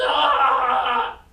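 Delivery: high loud yelp of pain at the needle. Retold or imitated. Patient mocking the nurse's stabs, sharp pained yelp. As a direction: yelp like a needle just stabbed you. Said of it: "it's like".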